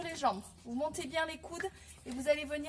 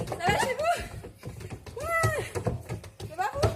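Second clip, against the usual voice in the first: excited call; 10 semitones higher than usual. Real, not acted. Coach calling out during cardio.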